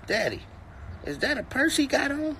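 put-on high, small kid's question; child voice. Father voicing his toddler son asking about a purse, high kid voice. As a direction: ask it like a curious little boy.

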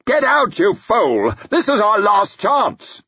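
high, loud desperate yell; furious yell. A narrator's reading. Salt yells at Glockter.

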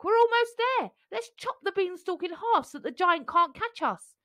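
high, urgent call; urgent shout. A narrator's reading. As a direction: shout it while climbing. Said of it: "shouted Jack".

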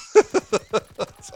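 high giggling fit; real laughter. Real, not acted. Gamer finishes his own skit, cracking up laughing. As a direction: crack up helplessly.

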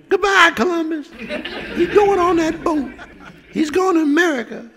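put-on high squealing girls waving goodbye; screaming girls. Girls on the pier screaming goodbye to Chris, in a high squeal. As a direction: shriek it like a crowd of fans.

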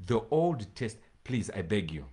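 ordinary talk, nothing marked

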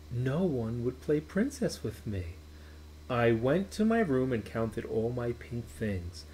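plain reading voice

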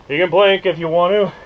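ordinary delivery

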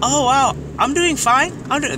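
high, loud delighted reply; pleasantly surprised worker. Acted. Counter worker being asked how they are, delighted surprise. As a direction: sound amazed that someone asked. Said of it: "Be like".

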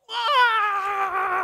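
long, loud, high-pitched scream; distant running scream. Acted. Healed man running in from over the bluff, long high scream. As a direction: scream it out like an oncoming train. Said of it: "hear him coming like a train".

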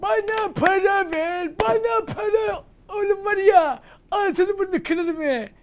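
high, slow, loud put-on tough-guy voice; mock street thug. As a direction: threaten like a comic street bully. Thug voice demanding money. (abartılı kabadayı taklidi)

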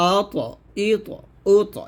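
আ, ত with a full echo on the stopped ত; heavy qalqalah. Shown as a demonstration. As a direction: bounce ত off each vowel, thick and rounded. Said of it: "ত দিয়ে করলে হবে".

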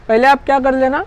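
normal talking voice

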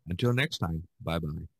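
usual speaking voice

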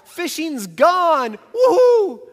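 giddy, high and loud cheer; wowed disciples. Acted. Fishermen leaving the boats, whooping with excitement. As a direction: shout for joy like freed fishermen. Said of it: "They're wowed".

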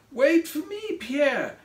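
high, pleading call after someone; crying out. A narrator's reading. Craig to Pierre who is too fast, crying out. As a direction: call out as if left behind. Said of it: "he cried".

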